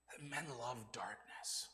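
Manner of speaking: slow hushed whisper; ominous whisper. Acted. Preacher warns the congregation in a slow hushed whisper. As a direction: let the words sink into a whisper.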